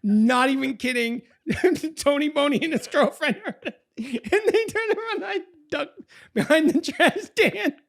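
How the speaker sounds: very high, loud squeal of laughter; helpless laughter. Real, not acted. Storyteller reliving hiding behind trash cans, helplessly laughing. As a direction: squeak the words out while cracking up.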